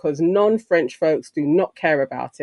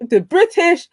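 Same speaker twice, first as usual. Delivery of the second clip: real giggle, pitched up; laughing at a slip; 6 semitones higher than usual. Real, not acted. Host fumbling the awards name on air, laughing. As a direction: laugh your way through the corrected name.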